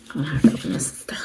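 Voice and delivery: hushed whisper to herself; whispered aside. Real, not acted. Murmuring to herself over the royal book. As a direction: breathe it out as a private aside.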